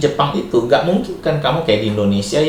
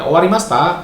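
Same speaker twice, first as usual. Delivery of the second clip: OWARIMASHITA lifted higher as a question; "Owarimashita" pitched up, asking if it's finished; rising question intonation; 6 semitones higher, 4 dB louder than usual. Shown as a demonstration. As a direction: ask "Owarimashita?" with the pitch climbing. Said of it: "Ya naik".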